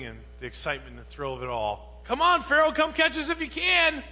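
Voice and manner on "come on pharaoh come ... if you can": much higher and louder, a hammed-up dare; sarcastic, pumped-up challenge to Pharaoh; mock cocky taunt. Mocking the Israelites' imagined bravado.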